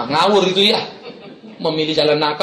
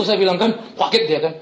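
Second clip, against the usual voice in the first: ordinary delivery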